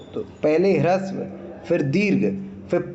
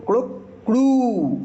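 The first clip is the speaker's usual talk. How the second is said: higher, stretched crowing call; rooster crow; 7 semitones higher than usual. Shown as a demonstration. Teacher imitating a rooster again. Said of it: "जैसे कुक".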